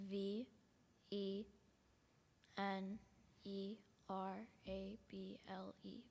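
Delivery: low, soft letter by letter spelling. Real, not acted. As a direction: spell each letter out carefully.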